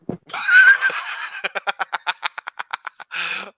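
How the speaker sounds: long, high, loud laugh; real belly laugh that keeps going; loud burst of laughter. Real, not acted. Co-host after the sex tape joke, laughing out loud.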